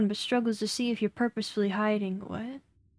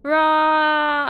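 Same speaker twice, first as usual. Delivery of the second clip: mock monster roar; 3 semitones higher, 10 dB louder than usual. Mock jump scare at the viewers.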